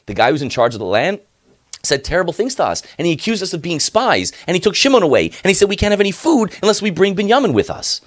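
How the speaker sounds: high, hurried, nervous report; flustered sons. Acted. Sons nervously explaining to their father what happened.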